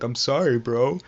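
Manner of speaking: slightly high mock-crying voice; imitated tearful apology.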